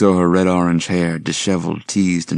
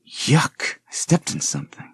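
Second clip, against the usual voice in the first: disgusted; 10 semitones higher than usual. Acted. Stepping in something gross.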